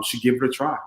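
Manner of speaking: usual speaking voice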